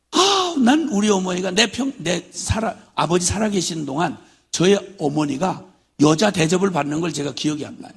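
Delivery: normal talking voice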